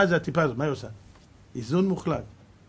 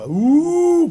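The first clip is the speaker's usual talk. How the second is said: long, high, loud wailing "oooo"; imitated air-raid siren; 11 semitones higher, 6 dB louder than usual. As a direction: wail upward like a siren.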